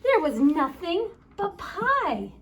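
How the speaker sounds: ordinary delivery, nothing marked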